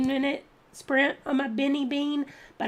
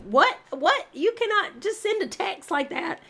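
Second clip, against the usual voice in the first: shocked disbelief; 9 semitones higher than usual. Retold or imitated. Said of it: "I was like".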